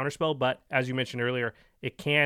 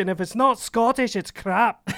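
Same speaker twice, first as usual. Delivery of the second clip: loud, high mock Scottish with a laugh; Scottish accent; 9 semitones higher, 5 dB louder than usual. Joking to co-host about the card, laughing mock Scottish. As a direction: ham up a Scottish catchphrase, laughing. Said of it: "She's Scottish".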